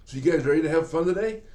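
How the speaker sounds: put-on dad cheering up his small boys before fishing; excited. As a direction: pump the kids up for the day. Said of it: "I said".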